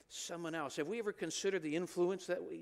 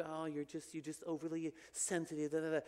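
softer, dismissive mock voice; mocking dismissal; 2 semitones lower, 4 dB quieter than usual. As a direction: belittle someone as oversensitive. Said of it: "the world pins you".

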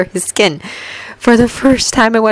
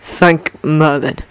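slow, low, through clenched teeth; through gritted teeth; 5 semitones lower, much slower than usual. A narrator's reading. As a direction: squeeze it through your teeth. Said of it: "he forced through his teeth".

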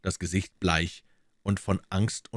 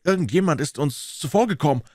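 shocked cry; 10 semitones higher, 5 dB louder than usual. A narrator's reading. American shouts in shock.

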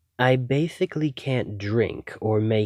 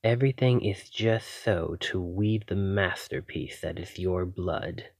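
usual speaking voice

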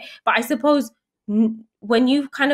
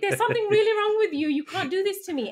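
laughing disbelief; 11 semitones higher, 3 dB louder than usual. Retold or imitated. Wife laughing at her husband's embarrassing advice, high mock outrage.

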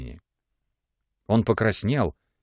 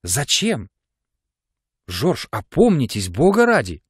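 дрожащим голосом; 3 semitones higher than usual. A narrator's reading. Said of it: "Голос её дрогнул и оборвался".

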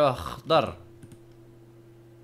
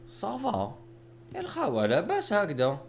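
excited call; 9 semitones higher than usual. Real, not acted. Delighted high call to viewers.

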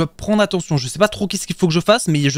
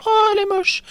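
much higher, mocking disgust; high excited disgust; 13 semitones higher than usual. Real, not acted. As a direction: squeal at how ugly she is. Sees ugly animatronic on camera. (dégoût amusé, voix bien plus aiguë)

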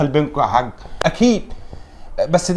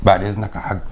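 quiet excuse; 11 semitones lower, 7 dB quieter than usual. Acted. Sneaking away quietly.

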